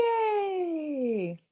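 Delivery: high, bright cheer; excited yay. Real, not acted. Teacher cheering kids for their success, excited.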